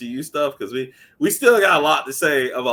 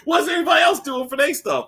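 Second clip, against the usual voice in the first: much higher, louder mock shout; 6 dB louder than usual. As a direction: keep up the hammed-up heated defence. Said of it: "he's like".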